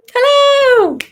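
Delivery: high, loud, sing-song hello after a laugh; bright hello. Real, not acted. Teacher popping back on camera to greet the children, cheery.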